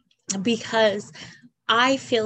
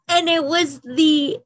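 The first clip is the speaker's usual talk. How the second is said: breaking into a real cry, slower and louder; 5 semitones higher, 6 dB louder than usual. Real, not acted. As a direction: voice cracking into tears. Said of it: "I apologize that I'm crying".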